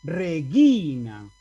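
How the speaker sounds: Regina con la I alargada y aguda; acento en la I larga. Shown as a demonstration. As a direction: marca y alarga la sílaba GI de Regina. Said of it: "Voy a leer". (Regina, cargando la voz en la I larga)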